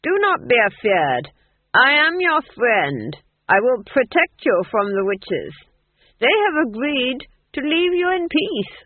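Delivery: loud, slow, grand proclamation; pompous ruler's public address; crying out. A narrator's reading. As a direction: pitch it high to the whole crowd. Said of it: "he cried".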